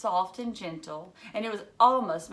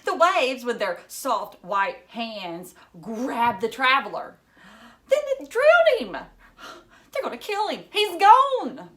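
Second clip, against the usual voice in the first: rising, louder dramatic retelling; barreling on; 5 semitones higher, 8 dB louder than usual. Acted. Girl retelling the poem to class, barreling on excitedly. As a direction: build it to a frantic climax. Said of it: "went barreling on".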